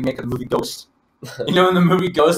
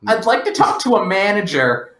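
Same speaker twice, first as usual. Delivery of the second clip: demanding customer; 7 semitones higher, 5 dB louder than usual. Acted.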